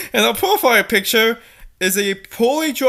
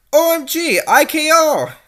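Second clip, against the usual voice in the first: mock teen girl; 5 semitones higher than usual. Voicing teen girl dialogue.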